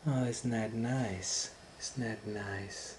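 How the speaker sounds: slow, soft, sugary wolf; sly wolf. Acted. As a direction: feign delight to trick her. Wolf feigns interest.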